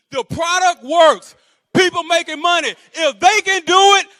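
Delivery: high, loud chanted slogans; rallying mantra. Shown as a demonstration. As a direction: hammer out each slogan for the crowd. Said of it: "this is the song".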